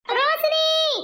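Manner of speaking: calling out. Acted. Calling out for missing Roshni.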